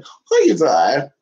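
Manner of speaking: stretched cooing question to a pet; slow sing-song to the dog; slow drawn-out coo. Acted.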